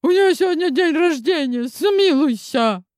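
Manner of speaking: high, pleading mother; desperate plea. Acted. Mother begs monster for mercy.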